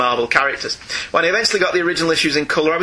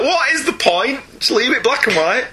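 mock exasperation; 6 semitones higher than usual. Quotes young readers' exasperated reaction.

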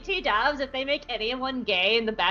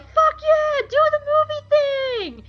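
much higher hyped-up cheer; excited cheer. Retold or imitated. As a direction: egg him on like rowdy brothers. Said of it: "all the Bad Batch are like".

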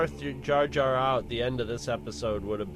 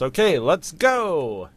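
loud, drawn-out, higher-pitched call; mock rallying call; 4 semitones higher, 8 dB louder, much slower than usual. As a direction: stretch it out like a mock rallying cry. Acts out Windu finishing quickly.

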